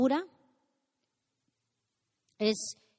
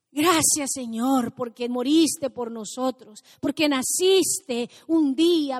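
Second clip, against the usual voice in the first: oración a gritos; 10 dB louder, slower than usual. Acted. Pastora proclama oración en voz alta.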